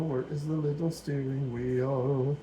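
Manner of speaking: idle sing-song to oneself; playful searching. Pretend. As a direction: draw it out while hunting for something. Hunts aloud for a piece.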